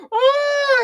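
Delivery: high, loud yelp of panic; reliving a panicked outburst at work; panicked cry. Retold or imitated. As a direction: cry out as the fear hits.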